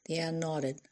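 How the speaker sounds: usual speaking voice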